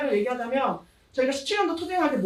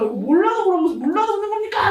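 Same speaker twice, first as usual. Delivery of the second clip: higher, louder retold protest; angry retort; 7 semitones higher, 5 dB louder than usual. Union worker retelling her angry retort to the executive, higher and louder. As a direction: snap back, reliving the anger. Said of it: "그래서 제가 너무 화가 나죠".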